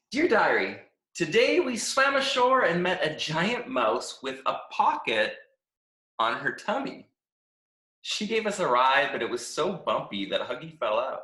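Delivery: upbeat, chirpy diary reading; a happy-go-lucky stick who loves every minute; cheerful Stick. Acted. Stick reads his cheerful diary.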